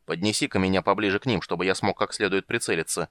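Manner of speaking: usual speaking voice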